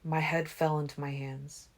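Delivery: ordinary delivery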